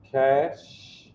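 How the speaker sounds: usual speaking voice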